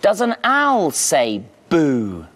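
ordinary delivery

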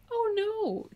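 high, controlled "Oh no"; restrained yelp. Pretend. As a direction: yelp but keep it polite. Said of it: "I have to be very careful about how I react".

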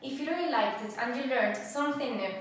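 high, loud and fast sign-off; bubbly channel outro asking for likes; upbeat outro. Acted. Host thanks viewers and asks them to subscribe, bubbly and fast.

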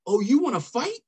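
high, loud mock challenge to a fight; imitated drill sergeant challenge. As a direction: call him out like an angry sergeant. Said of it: "He said".

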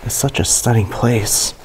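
usual speaking voice

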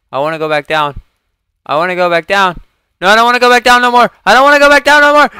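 real panicked yelling, building to a high frantic scream; loud, high panic that climbs and speeds up; freaking out. Real, not acted. Gamer panicking at a bug they just found, freaking out. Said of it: "I'm freaking out right now".

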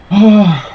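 high, loud groaning sigh; loud anguished sigh. Real, not acted. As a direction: let out a pained sigh. After dying in the fight.

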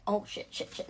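breathless hushed cursing; whispered curse. Real, not acted. Something goes wrong with the dog mid-stream, hushed cursing. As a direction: swear under your breath in a whisper.

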